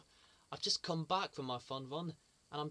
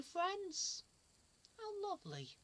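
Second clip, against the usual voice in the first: much higher, softer sweet coo; 13 semitones higher, 6 dB quieter, slower than usual. Acted. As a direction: coo like to a baby. Sweet response to friends.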